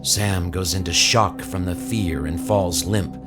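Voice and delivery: ordinary talk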